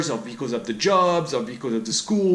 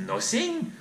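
sarcastic nothing; 10 semitones higher, 5 dB quieter than usual. Real, not acted. Answering his own question.

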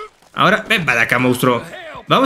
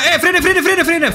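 urgent call; 9 semitones higher, slower than usual. Real, not acted. Car about to crush horse.

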